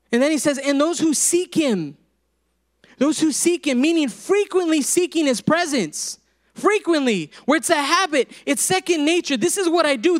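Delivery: impassioned preaching. Real, not acted. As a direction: drive each phrase home from the pulpit.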